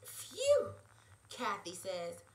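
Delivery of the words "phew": high, loud swoon; swooning phew. Acted. Kathy reacts to Quinn's accent, a high loud swooning phew.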